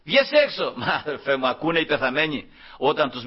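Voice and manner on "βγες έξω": high, raised summons; commanding preacher's call; loud command. Acted. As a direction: call him out with force. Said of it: "Δυνατά, ε".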